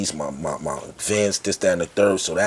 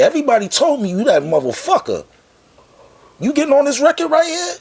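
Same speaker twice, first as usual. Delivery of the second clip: much higher, louder mocking mogul voice; incredulous boss; 6 dB louder than usual. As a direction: look him up and down in disbelief. Boss pressing rapper to record.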